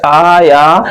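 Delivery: "आ या" with both syllables held; slow, sustained "आ या"; stretched vowels. Shown as a demonstration. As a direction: draw out aa and yaa in "आ या". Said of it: "इसलिए इनको खींच रहे हैं".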